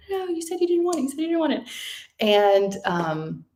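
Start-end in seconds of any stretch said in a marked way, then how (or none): 0.1-1.6 much higher, mock-scolding husband voice; lightly mock the coaching husband; imitating husband. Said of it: "He's like".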